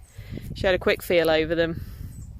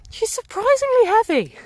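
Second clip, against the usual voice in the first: strained; 6 dB louder than usual. Real, not acted. Alpaca climbs on her back.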